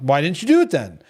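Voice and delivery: normal talking voice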